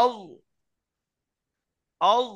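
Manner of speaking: আউ with both lips rounded; rounded lips demo. Shown as a demonstration. Teacher leading muted students, rounded আউ. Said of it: "ঠোঁট দুটো গোল হবে".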